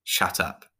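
plain reading voice

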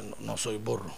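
low, quiet self-denial; hushed, downcast protest; meek denial. Acted. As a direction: mumble a denial to yourself, low. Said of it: "Usted dice".

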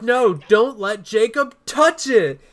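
high, loud, slow alarmed yell; frantic shout. Retold or imitated. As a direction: shriek to stop him. Girl jumps over the couch.

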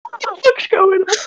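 lost completely, squeak it out; baffled outburst. Real, not acted. Baffled by the story.

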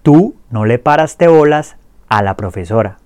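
clear repeat-after-me drill. Shown as a demonstration. Teacher drills the conjugations for students to repeat, clear and steady. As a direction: say each line clearly for learners to echo. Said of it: "Repitan después de mí".

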